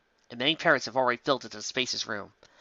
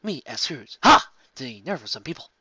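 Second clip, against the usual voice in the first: grumbling; 4 dB louder than usual. A narrator's reading. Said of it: "Kirk grumbled".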